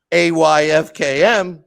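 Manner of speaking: loud, slow spelled-out letters; emphatic retort. Retold or imitated. Boss answering an employee in his own acronym, emphatic retort. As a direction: fire each letter back loudly, drawn out.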